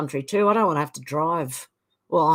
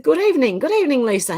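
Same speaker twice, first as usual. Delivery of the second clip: sing-song greeting; 7 semitones higher, 4 dB louder, faster than usual. Real, not acted.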